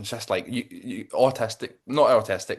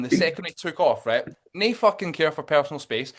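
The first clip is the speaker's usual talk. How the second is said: normal talking voice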